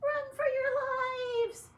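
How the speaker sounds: very high, slow, loud squealing scream; panicked mice scream. Acted. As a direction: shriek and run like tiny mice. Soldiers flee in panic.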